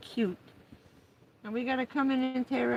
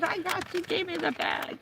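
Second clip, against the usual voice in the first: higher, gleeful dog speaking; excited dog voice; 8 semitones higher than usual. Pretend. Owner voicing excited dog with the bag. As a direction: play the thrilled dog. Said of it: "He's all excited".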